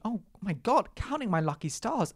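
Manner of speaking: high, bright "oh my God"; excited relief. Retold or imitated. On the platform seeing her train, excited. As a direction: play the thrilled runner spotting the train. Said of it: "I'm thinking".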